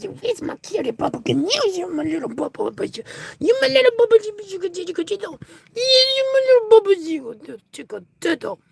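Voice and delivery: very high, sing-song cooing with loud bursts. Real, not acted. Baby talk to the cat.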